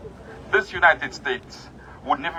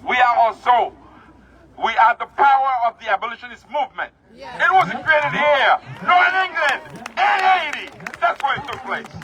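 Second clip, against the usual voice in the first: preaching at full force; 9 semitones higher than usual. Acted. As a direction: preach it to the crowd.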